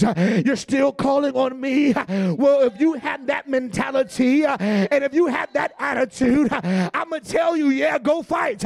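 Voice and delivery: loud, rising preacher's exhortation; fiery preaching. Acted. Pastor exhorting congregation about David's losses, full-force preaching. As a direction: drive it home to the congregation.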